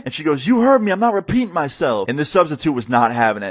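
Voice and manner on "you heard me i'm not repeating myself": defiant. Retold or imitated. Student talking back to the substitute, defiant.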